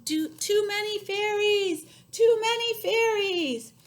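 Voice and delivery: very high, loud, slow shout; desperate shout. Acted. Old woman shouting at the crowd of fairies. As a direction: wail it out, overwhelmed. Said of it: "shouted the old woman".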